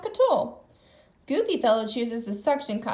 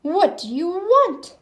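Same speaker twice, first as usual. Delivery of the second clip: slow, louder beast demand; roaring beast; 5 semitones higher, 6 dB louder, slower than usual. A narrator's reading. Beast Pete demands at the door, a slow loud roar. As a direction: growl it out at the door. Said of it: "he roars".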